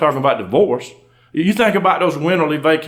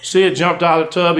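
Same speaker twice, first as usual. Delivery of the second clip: ordinary delivery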